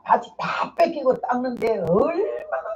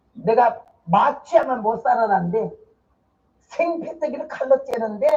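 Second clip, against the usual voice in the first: ordinary talk, nothing marked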